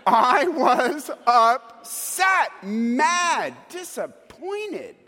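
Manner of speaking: high, drawn-out retold outburst; exaggerated exasperation, word by word; emphatic upset. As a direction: punch out each feeling slowly. Recalls his anger, word by word.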